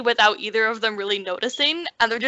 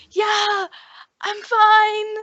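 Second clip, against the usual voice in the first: high-pitched strained-to-be-heard reply; calling back; 11 semitones higher, 3 dB louder, much slower than usual. Acted. Windblown princess answering her companion, calling back high. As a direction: call back high over the wind.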